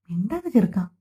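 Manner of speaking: usual speaking voice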